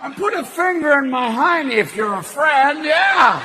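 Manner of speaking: loud folksy aw-shucks voice; Jimmy Stewart. Retold or imitated. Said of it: "Did Jimmy Stewart basically go".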